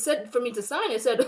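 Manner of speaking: ordinary delivery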